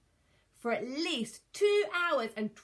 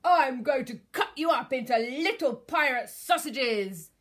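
loud, menacing dinosaur voice; big monster yell; yelled threat; 4 semitones higher, 10 dB louder than usual. A narrator's reading. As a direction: bellow it with villainous force. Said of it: "yelled the Tyrannosaurus Rex".

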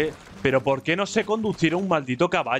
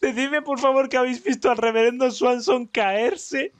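still giggly; 9 semitones higher than usual. Real, not acted. Still laughing at reverend.

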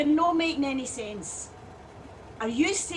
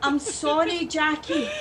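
ordinary talk

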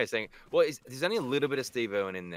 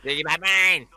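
higher, louder shouted catchphrase; gleeful catchphrase; 7 semitones higher, 9 dB louder than usual. Pretend. Echoing the catchphrase, loud gleeful shout. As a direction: bellow it like a proud mate.

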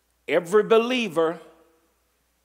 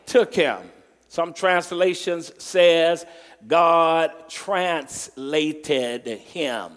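scripture reading; 4 dB louder, much slower than usual. Acted.

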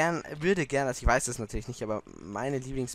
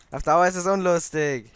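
ordinary talk, nothing marked

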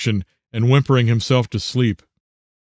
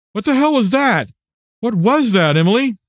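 high, urgent demand; alarmed father; 8 semitones higher than usual. A narrator's reading. Father running to his screaming daughter, high alarmed questioning. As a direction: pitch jumps up in alarm.

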